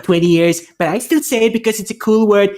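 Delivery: ordinary delivery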